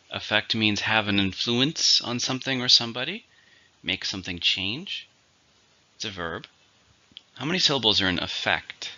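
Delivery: usual speaking voice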